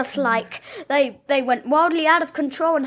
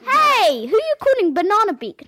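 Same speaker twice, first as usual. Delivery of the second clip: much higher, fast indignant voice; indignant bird; 6 semitones higher than usual. Acted. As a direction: squawk back, offended and flustered. Child performs play character line.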